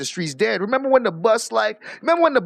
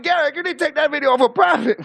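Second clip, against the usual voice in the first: high accusing quote through laughter; half laugh, half yell the line; gleeful retold accusation; laughing mock accusation; 6 semitones higher than usual.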